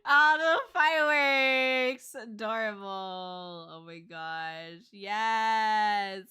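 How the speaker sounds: slow, drawn-out swooning cries; fangirling hard. Real, not acted. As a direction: gush loudly, stretching words into a rising squeal. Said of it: "I'm just fangirling so hard".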